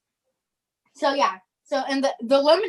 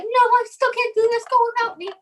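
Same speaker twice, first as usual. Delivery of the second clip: high, pleading whine; whiny refusal; 11 semitones higher, 4 dB louder, faster than usual. Retold or imitated. Olivia refusing to perform before the show, a high whine. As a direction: beg to be left out, voice up high. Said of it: "she's like".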